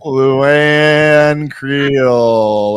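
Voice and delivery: high, loud, drawn-out winner call; winner announcement. Acted. Streamer crowns the winning duck with a long announcer call. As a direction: stretch the name out like a ring announcer.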